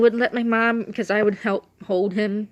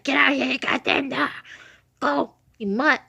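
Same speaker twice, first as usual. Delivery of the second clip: dad's raised-pitch, exasperated holler; mock angry yell; 6 semitones higher than usual. Dad chasing the dog off the lawnmower, mock angry yell. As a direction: imitate a fed-up dad shooing the dog.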